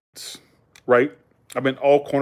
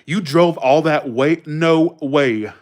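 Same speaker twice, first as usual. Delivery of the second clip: slow, stretched Valley emphasis; mock West Coast accent, leaning on each word; emphatic California speech; much slower than usual. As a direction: imitate a disbelieving Californian. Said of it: "they put emphasis".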